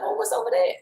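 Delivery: curious daughter. Retold or imitated. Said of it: "She's like".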